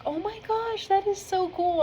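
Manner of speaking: high-pitched, delighted reenactment; excited reaction. Retold or imitated. Learning the concert is tonight, excited and high. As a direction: jump way up in pitch, thrilled by the discovery.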